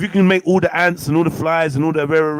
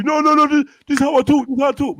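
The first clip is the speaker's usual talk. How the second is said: high-pitched, insistent protest; mock protesting; 10 semitones higher, 3 dB louder, faster than usual. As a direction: plead your case in a raised, higher voice. Acted protest to mocked crocodiles.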